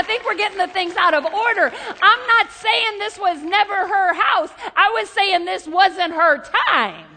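loud, slowing with a laugh on the last line; emphatic preaching. Acted. Preacher insisting to the church, loud and emphatic. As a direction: drive the point home loudly.

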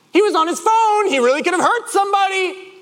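loud, very high protest; hammed-up indignant outburst; exasperated shout. Driver arguing with his calm friend about the careless motorist, exasperated shout.

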